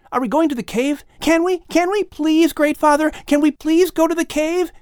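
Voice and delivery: put-on high, eager child's voice pleading; a small boy begging his great father; young Lamech begging to see the cave, high and eager. A narrator's reading. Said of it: "young Lamech begs".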